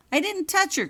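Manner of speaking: high, loud hurried denial; a frightened prefect cuts off the headmaster; quick protest. A narrator's reading. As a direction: blurt it out in panic. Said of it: "cut in Richards quickly".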